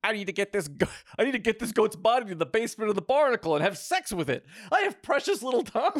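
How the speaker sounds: high, loud, rushed panic; frantic high-pitched self-talk. A narrator's reading. Frantic character talking to himself aloud.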